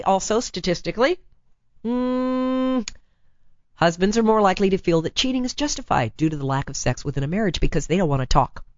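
ordinary talk, nothing marked

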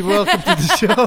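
giggling as you greet; laughing. Real, not acted. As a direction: welcome said through laughter. Laughing through welcome.

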